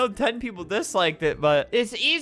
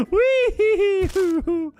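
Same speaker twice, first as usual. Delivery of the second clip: high, drawn-out "whee" with giggly bounce; playful gleeful whoop; 10 semitones higher, 3 dB louder, slower than usual. Pretend. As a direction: whoop like riding a slide. Gleeful noise while destroying builds.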